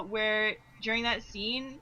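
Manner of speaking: normal talking voice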